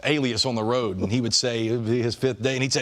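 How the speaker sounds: usual speaking voice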